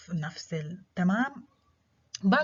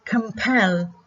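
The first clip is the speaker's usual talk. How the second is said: compel, louder on pel; stress on PEL; 6 dB louder than usual. Shown as a demonstration. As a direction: hit the second syllable of compel. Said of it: "stress on the second syllable".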